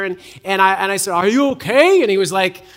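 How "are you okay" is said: high, loud put-on concern; grandpa in character. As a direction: play the worried fake grandpa. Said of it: "in, in character".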